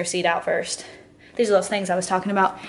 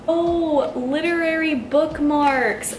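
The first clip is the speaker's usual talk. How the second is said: high, slow delighted oh; drawn-out pleased surprise; surprised realization; 6 semitones higher, 5 dB louder, much slower than usual. Real, not acted. Surprised discovery of bookmarks.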